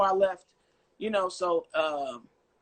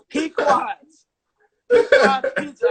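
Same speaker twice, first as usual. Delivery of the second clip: high, loud shout of the name; hammed-up call to the pizza place; 6 semitones higher, 10 dB louder, slower than usual. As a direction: call out across the city.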